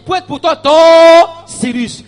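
loud, high-pitched preaching with stretched peaks; full-force preaching. Real, not acted. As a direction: preach it hard to the congregation.

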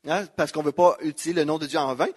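usual speaking voice

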